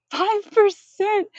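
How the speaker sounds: high slow squeaky voice; cat voice. Pretend. Mock cat stat commentary.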